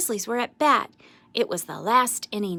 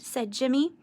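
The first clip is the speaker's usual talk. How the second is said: ordinary delivery, nothing marked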